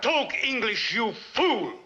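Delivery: high, irritated order; angry rebuke. Acted. Premier scolding an underling for his accent, angry rebuke. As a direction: cut down a bungling underling.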